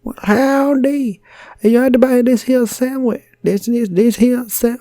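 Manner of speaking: high, slow, loud Southern drawl; cowboy shopkeeper, way up high; American shopkeeper accent. Retold or imitated. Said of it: "I can only do two American accents".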